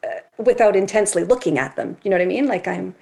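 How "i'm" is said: normal talking voice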